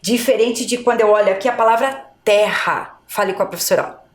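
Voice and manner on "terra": terra with a strong H-like RR. Shown as a demonstration. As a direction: push the double R in terra. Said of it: "A pronúncia é forte".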